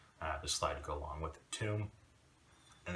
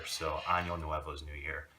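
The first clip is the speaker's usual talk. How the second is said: normal talking voice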